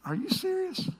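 a mild, pretend shock at the tithe; mock disbelief. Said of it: "And you're like".

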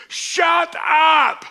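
loud, high-pitched disbelieving shout; shocked shout. Retold or imitated. A stranger on the trip hearing he's a pastor, shocked shout. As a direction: yell it out in total disbelief. Stunned stranger retells reaction.

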